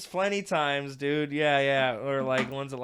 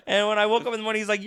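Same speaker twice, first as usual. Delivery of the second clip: ordinary talk, nothing marked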